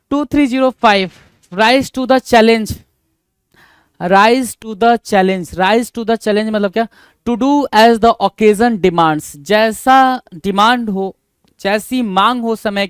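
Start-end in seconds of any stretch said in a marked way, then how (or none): 1.5-6.5 very slow, word by word; slow dictation. Shown as a demonstration. Teacher dictates idiom slowly. 7.3-9.4 drawn out, louder; slow dictation. Shown as a demonstration. Dictating meaning.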